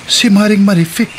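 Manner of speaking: suddenly sure it's her; stunned recognition. Acted. Recognizes the beggar, astonished. (gulat na pagkakilala, malakas)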